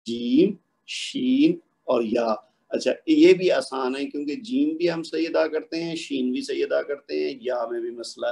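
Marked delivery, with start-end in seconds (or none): 0.0-2.3 جیم, شین and یا said very slowly with the mid tongue on the palate; slow, careful جیم شین یا; mid-palate letters demo. Shown as a demonstration. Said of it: "یہ تینوں حروف ادا ہو جائیں گے".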